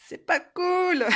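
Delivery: high, loud mock complaint before a laugh; mock indignation. Real, not acted. Complaining about shipping costs.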